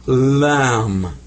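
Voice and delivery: "lam" drawn out slowly with a clear L and open A; Arabic lam. Shown as a demonstration. Teacher introducing the Arabic letter to students, said slow and clear. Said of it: "In Arabic".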